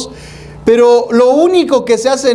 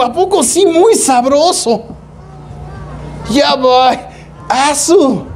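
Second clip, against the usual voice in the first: high, teasing street voice; barrio slang; 5 semitones higher, slower than usual. Acted. A hint of a neighborhood guy mocking the faithful. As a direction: tease like a guy from the block. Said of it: "Y yo dije luego".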